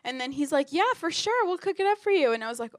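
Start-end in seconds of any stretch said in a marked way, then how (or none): 0.7-2.3 high-pitched imitation of an eager-to-help cook; obliging cook. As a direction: agree readily as the cook, pitched well up. Voices the owner agreeing.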